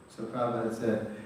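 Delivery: ordinary talk, nothing marked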